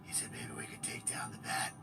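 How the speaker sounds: hushed conspiratorial whisper. Acted. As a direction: whisper it to the camera. Conspiratorial vlog aside.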